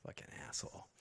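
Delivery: unvoiced hiss at the chat; whispered aside. Real, not acted. Host whispers an insult at a chatter, whispered. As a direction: whisper it under your breath.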